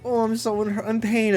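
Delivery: dog's mock pain voice. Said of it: "acting like".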